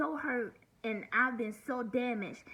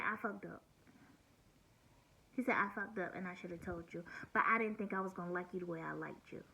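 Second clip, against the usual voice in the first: softer, lower retelling of Bob's sheepish apology; quiet mock confession; 4 semitones lower, 7 dB quieter, faster than usual. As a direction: mumble it like a guilty man.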